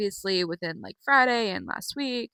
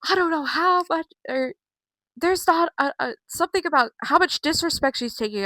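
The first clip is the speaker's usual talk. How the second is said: higher-pitched mock tantrum; Becky; 7 semitones higher than usual. Vlogger retelling Becky's fit, mock tantrum. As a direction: imitate someone throwing a fit. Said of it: "She's throwing a fit".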